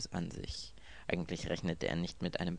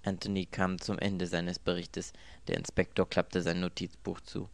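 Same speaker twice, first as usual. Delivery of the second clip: usual speaking voice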